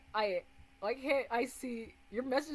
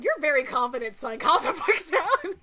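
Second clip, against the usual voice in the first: loud, higher-pitched mock telling-off; retold outburst; 3 semitones higher, 10 dB louder than usual. Streamer quoting someone telling him to calm down, mock outburst. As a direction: bark it through laughter, as an impression. Retelling someone's cocky put-down.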